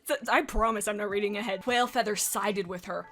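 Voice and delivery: ordinary delivery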